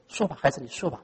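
soft, fading tender invitation; gentle permission. Pretend. God gently invites his grieving child to speak, soft and tender. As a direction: coax quietly like a parent.

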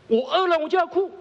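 put-on high, loud babyish whine; demanding baby. Hungry baby insisting on crying, high and loud.

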